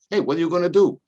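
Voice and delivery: high, loud shrug of a Josephine voice; Italian parishioner. Retold or imitated. Sick parishioner shrugging off the priest's question, loud and high. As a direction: toss it off like a resigned old parishioner.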